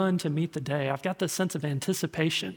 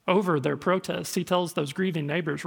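ordinary talk

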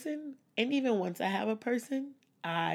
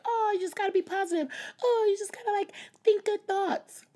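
very high, loud, saccharine pep talk; mock positivity; 14 semitones higher, 5 dB louder than usual. Mimicking people who push positive thinking, squeaky mock cheer. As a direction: parody a relentlessly positive advice-giver.

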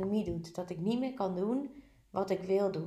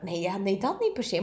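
usual speaking voice

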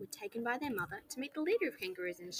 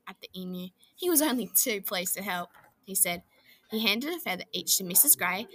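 usual speaking voice

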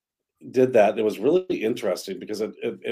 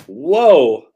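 loud, high whoa of surprise; surprised whoa; 4 semitones higher, 12 dB louder than usual. Real, not acted. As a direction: gasp it out in amazement. Reacts to new picture.

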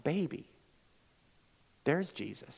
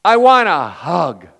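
slow, louder heartfelt ask; earnest plea; 3 semitones higher, 15 dB louder, slower than usual. Retold or imitated. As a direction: lean in and ask for it plainly but loud. Homeless man asks for hug.

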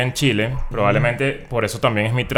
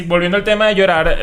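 ordinary talk

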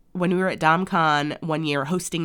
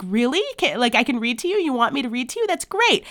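higher-pitched eager surprise, acted out; excited; 7 semitones higher than usual. Host mimics husband offered a chance to read aloud, excited. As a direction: play him delighted, rising to a high finish. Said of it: "he gets excited".

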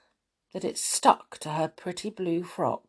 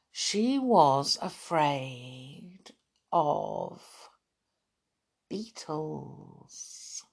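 slow hushed reveal; 3 semitones lower, 6 dB quieter, much slower than usual. A narrator's reading. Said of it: "narrator builds suspense".

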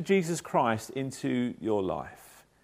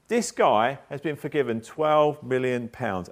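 ordinary talk

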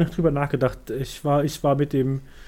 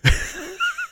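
high laugh. Real, not acted. Laugh before topic reveal.

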